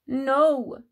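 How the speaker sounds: loud firm no; refusing Big Mouse. A narrator's reading. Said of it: "said Big Mouse".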